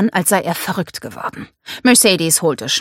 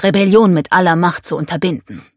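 plain reading voice

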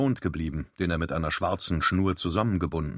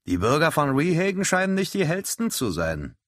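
grumbling; 10 semitones higher, 4 dB louder than usual. A narrator's reading. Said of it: "brummte Grady".

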